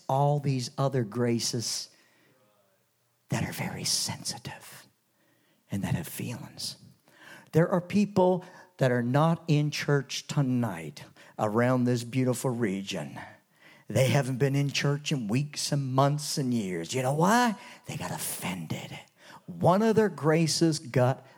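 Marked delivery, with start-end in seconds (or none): 3.3-6.8 hushed, breathy whisper; softly whispered aside. Acted. Whispered aside on sensitive graces. 17.9-19.0 whisper. Acted. Hushed punchline in sermon.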